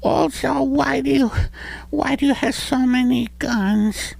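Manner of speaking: put-on high little boy voice; kid voice. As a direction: play a sweet little boy. Child voice.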